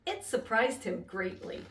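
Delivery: ordinary delivery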